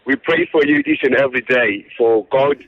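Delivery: normal talking voice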